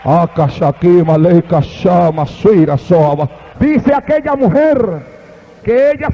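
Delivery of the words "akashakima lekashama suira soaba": low, slow, otherworldly tongues; speaking in tongues. Real, not acted. Preacher to the congregation, low slow speaking in tongues. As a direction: drop deep into prayer tongues, slowly.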